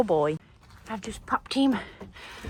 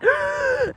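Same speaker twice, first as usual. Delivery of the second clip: sudden high gasp of alarm; mock shocked gasp; imitated sharp gasp; 5 dB louder than usual. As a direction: gasp as if he'd slipped up. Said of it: "No, I'd be like".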